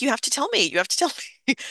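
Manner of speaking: insist, voice climbing in panic; frantic demand. Retold or imitated. Teen begging mother on the phone to tell her, frantic. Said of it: "brief, frantic exchange".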